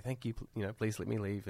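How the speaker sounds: soft, humble mock-polite plea to the boss; bow and beg softly to leave; meek bowing thanks. To the boss, a meek quiet thank-you. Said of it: "I usually go, I bow and I say".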